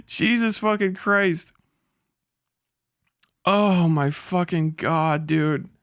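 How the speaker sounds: normal talking voice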